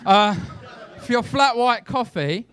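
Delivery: normal talking voice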